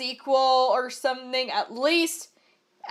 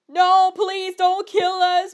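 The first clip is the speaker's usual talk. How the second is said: higher, slow desperate plea; slow pleading for mercy, voice raised; 6 semitones higher, slower than usual. A narrator's reading. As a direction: beg for your life, drawn out. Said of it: "pleaded Vampire".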